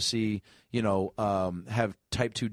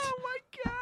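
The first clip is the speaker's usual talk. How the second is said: high shocked squeak; 8 dB quieter, faster than usual. Real, not acted. Shocked reaction.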